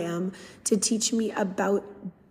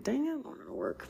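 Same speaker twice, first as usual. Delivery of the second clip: much softer, muttered under the breath; private grumble; 4 semitones higher, 12 dB quieter than usual. Retold or imitated. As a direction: grumble it to yourself, hidden. Inner grumble, inside disappointed.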